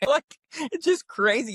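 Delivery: high, laughing through the words. Real, not acted. Telling the tiny guy in a huge truck, laughing. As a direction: laugh it out, amused. Laughing at his own story.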